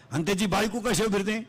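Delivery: ordinary talk, nothing marked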